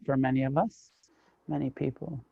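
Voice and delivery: normal talking voice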